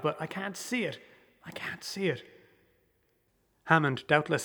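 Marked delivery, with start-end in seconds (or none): 0.0-2.2 unvoiced, terrified whisper; horrified whisper. A narrator's reading. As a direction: breathe it out in horror. Terrified, cannot see the thing.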